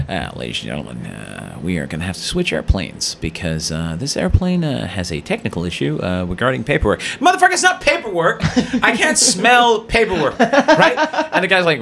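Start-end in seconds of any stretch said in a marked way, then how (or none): 0.1-7.0 low, subdued PA announcement voice; airline pilot. Retold or imitated. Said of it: "then the pilot comes on and goes". 7.2-11.0 high-pitched incredulous retort; mock outrage. Acted retort to the pilot.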